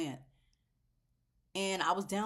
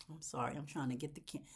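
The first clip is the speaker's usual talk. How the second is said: ordinary talk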